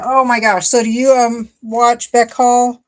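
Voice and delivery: light up at the news; excited. Real, not acted. Reacting to viewer in Australia.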